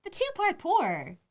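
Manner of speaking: "The two-part pour" dragged out high and slow; stressed scoffing phrase. Real, not acted. Mocking friend's "The two-part pour" Guinness ritual, slowly and high. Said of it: "The way you pour it".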